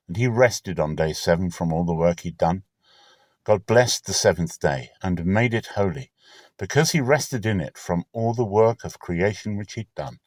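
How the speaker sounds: plain reading voice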